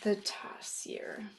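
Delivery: slow hushed whisper. Acted. Naming the sneaky tarsier to children, whispering. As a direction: breathe the name secretly.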